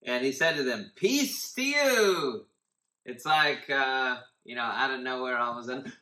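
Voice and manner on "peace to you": higher, louder and slow proclamation; grand Jesus greeting. Acted. Bible teacher voicing Jesus to the startled disciples, slow and grand. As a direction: announce the greeting like a grand entrance.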